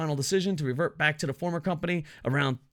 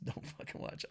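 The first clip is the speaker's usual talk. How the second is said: laughing whispered taunt; whispered mock jab; 15 dB quieter than usual. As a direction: breathe it out as a giggling whisper.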